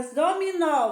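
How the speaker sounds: "dominó" landing firmly on "nó"; stressed last syllable. Shown as a demonstration. Teacher picking out the syllable to colour, stress on "nó". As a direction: punch the final "nó" of "dominó". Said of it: "você vai pintar o nó".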